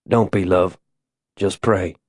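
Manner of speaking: low, hushed husband's voice; tender reassurance. Acted. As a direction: soothe her gently, dropping low and soft. Husband reassures scared wife.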